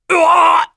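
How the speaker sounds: loud, high yelp of pain; sharp loud startled yelp; pained cry. Acted. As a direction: cry out as you're grabbed. Cry while being grabbed.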